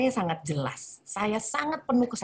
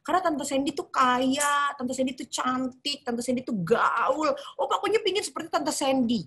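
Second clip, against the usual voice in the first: put-on child's answer; 6 semitones higher than usual. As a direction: voice the kid who adores her aunt. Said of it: "Terus saya tanya".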